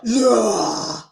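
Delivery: usual speaking voice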